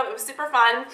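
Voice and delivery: usual speaking voice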